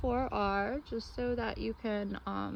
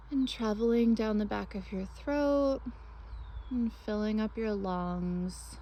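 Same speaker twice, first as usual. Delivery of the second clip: guided meditation. Acted. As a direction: lead them gently through breath and imagery, phrase by phrase.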